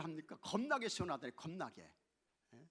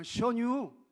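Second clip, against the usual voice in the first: loud, raised Chungcheong 시원유; Chungcheong dialect; 6 semitones higher, 11 dB louder, slower than usual. Acted. Preacher contrasts it with other regions, Chungcheong dialect. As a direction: say 시원유 as a punchy Chungcheong one-worder. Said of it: "우리 충청도 사람들은 너무 빨라".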